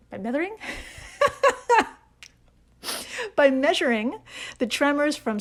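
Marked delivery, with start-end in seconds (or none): 0.1-0.6 MEASURING as "methering", rising like a question; questioning own slip. Real, not acted. Podcaster querying their own fumbled reading, MEASURING as a questioning "methering". 0.6-1.9 high burst of laughing; real laughter. Real, not acted. Podcaster cracking up over a misread word, high helpless laugh.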